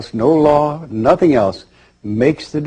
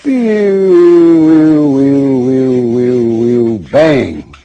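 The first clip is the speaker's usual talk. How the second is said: whooshing sound effect; 4 semitones higher, 9 dB louder, much slower than usual. Acted. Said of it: "And you go".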